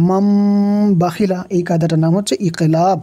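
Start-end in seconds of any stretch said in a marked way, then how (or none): 0.1-1.4 মাম with its final m hummed long; nasal ghunnah. Shown as a demonstration. Teacher demonstrating the rule, held nasal m. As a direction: hum on the m of মাম before releasing বাখিলা. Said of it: "গুণ হবে".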